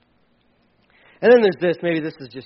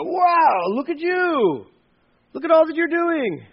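high-pitched mock amazement; wide-eyed mock praise, way up high; gushing admiration; 10 semitones higher, slower than usual. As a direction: gush over them like an impressed fan. Voicing admiring onlookers.

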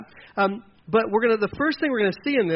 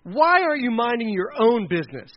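losing his temper; 5 semitones higher, 4 dB louder than usual. Acted. Older brother confronting little David at camp, temper lost. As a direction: scold him, pitched up and indignant. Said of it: "He loses his temper and says".

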